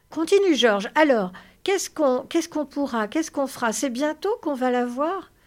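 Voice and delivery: high, loud, tumbling questions; eager impatience. Acted. Lénie presses George about the farm, eagerly impatient. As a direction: stumble over questions in excitement.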